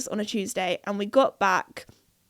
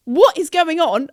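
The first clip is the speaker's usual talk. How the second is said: louder, higher alarmed question; freaking out; 5 semitones higher, 5 dB louder than usual. Retold or imitated. Friends trapped in the shop, alarmed outburst. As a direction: demand an answer in alarm. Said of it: "freaking out".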